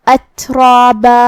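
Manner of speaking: اترابا said slowly with a weak, barely released t; slow with a heavy r and long a; soft ta. Shown as a demonstration. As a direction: ease off the t in اترابا. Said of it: "تا کو ہمزے سے پڑھنا ہے".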